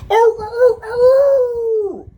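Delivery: loud, high animal howl; long wailing animal cry; animal noise. Shown as a demonstration. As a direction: howl out to grab the room. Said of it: "are animal noises".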